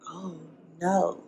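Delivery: ordinary talk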